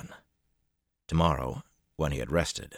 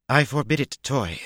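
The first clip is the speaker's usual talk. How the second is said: sharp, high, loud command; snapped; 10 semitones higher, 6 dB louder than usual. A narrator's reading. Tuon snapping at Matt, forbidding him, sharp. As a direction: snap it out like an order. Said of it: "Tuon snapped".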